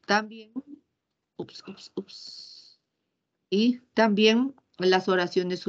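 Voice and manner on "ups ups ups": breathy "ups" under the breath; whispered oops. Real, not acted. Teacher catches her own slip, whispering. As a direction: whisper a quick oops to yourself. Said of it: "teacher mutters a slip".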